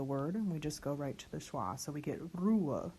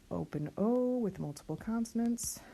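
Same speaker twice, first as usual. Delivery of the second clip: whisper; 5 semitones higher than usual. Real, not acted. Whispered aside during diction.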